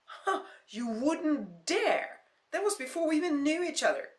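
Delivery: loud, high angry shout. Acted. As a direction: shout at him, furious. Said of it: "her face was angry red".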